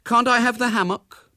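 put-on high, loud boyish plea; wheedling child's request; pleading. A narrator's reading. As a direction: beg for it like a small boy. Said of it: "pleaded William".

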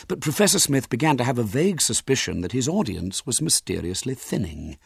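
ordinary delivery, nothing marked

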